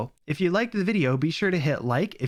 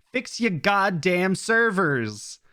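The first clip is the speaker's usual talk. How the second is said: slow, drawn-out shout, higher; mock angry shout; 4 semitones higher, much slower than usual. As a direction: yell it at the company, dragging each word. Yelling at Amazon.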